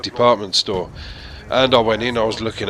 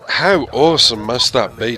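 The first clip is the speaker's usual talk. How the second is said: ordinary delivery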